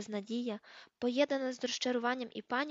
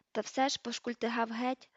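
ordinary delivery, nothing marked

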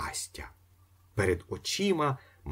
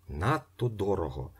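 plain reading voice

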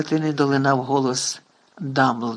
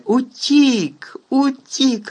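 high, loud sobbing wail; sobbing cry; 9 semitones higher, 5 dB louder, much slower than usual. A narrator's reading. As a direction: wail it through tears. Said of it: "ридав він".

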